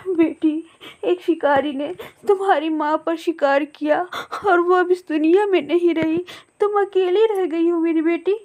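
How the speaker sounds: tearful, breaking the news to the orphan; aunt mournfully telling the chick her mother died; grieving aunt. Acted. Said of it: "रोने लगती है".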